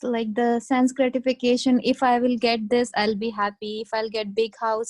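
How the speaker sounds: ordinary talk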